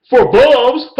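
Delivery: high, loud hammed-up laugh; mock punchline. Mocking the rival's Detroit joke with a high laugh. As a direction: ham up his joke with a shrill cackle.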